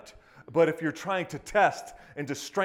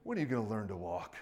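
much softer, low, fed-up sigh; exasperated parent; 7 semitones lower, 9 dB quieter than usual. Retold or imitated. Preacher mimics a parent scolding a falling toddler, exasperated mutter. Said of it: "what do you do".

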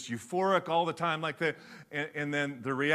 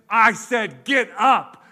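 much louder, higher furious order; loud second command, higher and forceful; shouted command; 6 semitones higher, 14 dB louder, much slower than usual. Retold or imitated. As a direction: bellow it at the horse. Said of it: "She hits it again".

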